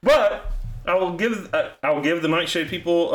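talking while blowing nose; words through a nose blow. Real, not acted. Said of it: "sorry, I'm blowing my nose".

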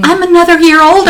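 high, loud and beaming; thrilled. Real, not acted. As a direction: crow it out with delight. Proud of turning sixty-six.

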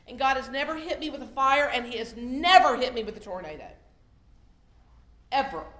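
thundering sermon climax; booming preaching. Real, not acted. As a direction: preach it out at full force. Preaching builds to a loud climax.

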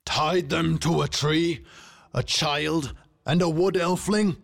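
high, incredulous kingly shouting; outraged shout. A narrator's reading. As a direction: throw their words back in outrage. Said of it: "he shouted".

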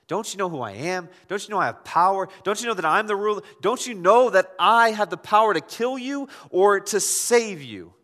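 retold demand building to loud and high; mounting insistence. Preacher as Pilate asserting power over Jesus, increasingly insistent.

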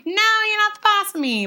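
high, fast and defiant, like a sassy little sister; bratty kid retort. Retold or imitated. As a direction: snap back like a stubborn ten-year-old. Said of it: "I'm like".

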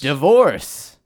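loud, high, hammed-up echo; mock dramatic. Echoing the co-host's scandalous word, mock dramatic. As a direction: belt it out like soap-opera scandal.

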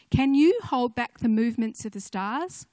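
loud, solemn proclamation of God's words to Job; scripture reading. Acted. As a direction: declaim it like God thundering from the heavens.

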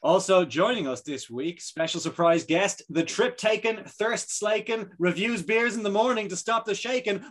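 rhyming hype-man intro; announcer intro. Acted. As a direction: introduce him like a ring announcer.

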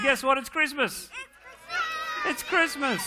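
bigger excitement. Acted. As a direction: go all out to get them cheering. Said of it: "We'll try again".

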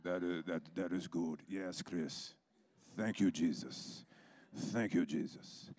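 slow, low, drawn-out approval; laid-back onlooker. Retold or imitated. Imitating an evangelist who just watches his partner, slow and low. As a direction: savour each phrase in a deep, unhurried voice. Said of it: "he's just watching Chris".

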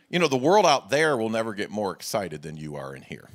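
ordinary talk

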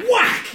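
high, loud shouted whack. Shown as a demonstration. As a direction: bark out the impact. DM voicing a hit sound.

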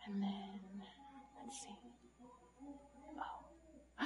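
soft whisper to self. Acted. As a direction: whisper as you work. Whispering while fitting pieces.

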